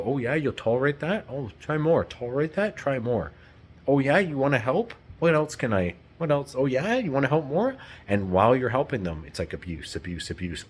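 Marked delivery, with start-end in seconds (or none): 0.0-7.8 higher, sneering taunt of a narcissist; mocking narcissist. Imitating a narcissist who demands more, higher sneering voice. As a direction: goad them to give more. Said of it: "They will just go".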